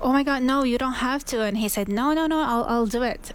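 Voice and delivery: ordinary talk, nothing marked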